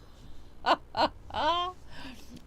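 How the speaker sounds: high, spaced-out "ha, ha, ha"; slow, deliberate show-off laugh; mock-smug laugh. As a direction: laugh at your own boast. Mock boast about being very clever.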